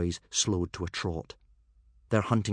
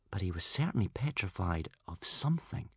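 plain reading voice

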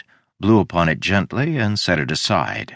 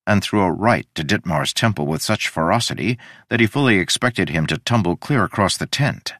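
plain reading voice

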